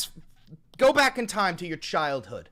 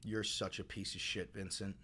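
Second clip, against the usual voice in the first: much lower and far softer, under the breath; quiet mutter; 11 semitones lower, 18 dB quieter than usual. Real, not acted. Streamer muttering insults at chatter Vincent after the rant, low mutter. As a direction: spit it out barely aloud, disgusted.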